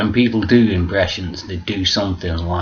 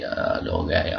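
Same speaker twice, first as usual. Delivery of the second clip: deep puzzled voice; 7 dB quieter than usual. Retold or imitated. Family doesn't understand.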